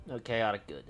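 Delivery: usual speaking voice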